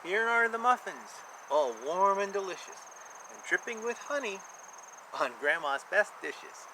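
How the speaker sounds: lilting rhymed picture-book read; sing-song storybook reading for a child; reading to children. A narrator's reading. Reads rhyming picture book aloud.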